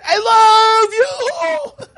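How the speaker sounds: comically stretched declaration; mock shouted endearment. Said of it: "You whisper, right?".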